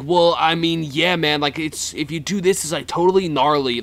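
ordinary delivery

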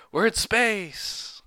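high, slow, amazed pilot; awed pilot voice. Retold or imitated.

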